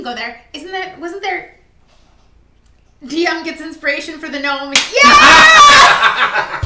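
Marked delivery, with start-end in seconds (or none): 4.9-6.6 loud triumphant cheer; excited shout. Real, not acted. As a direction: shout it out in celebration. DM gets inspiration, cheering.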